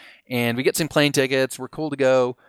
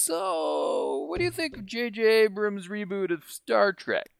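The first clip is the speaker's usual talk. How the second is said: high, drawn-out imitation with a put-on accent; 8 semitones higher, 5 dB quieter, slower than usual. Grand master quizzing friend on Star Trek, put-on accent. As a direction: stretch the 'so', then lilt high in a mock accent. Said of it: "nice, nice accent".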